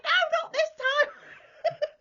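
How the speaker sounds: high, loud, slow laughing shriek; thrilled laughing squeal; excited squeal. Retold or imitated. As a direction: gleefully correct him. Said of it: "I'm like".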